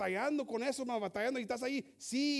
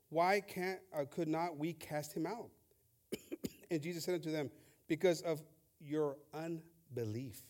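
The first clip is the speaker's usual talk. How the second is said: a solemn scripture read; 6 semitones lower than usual. Acted. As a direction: read the verses with weight. Reading scripture aloud.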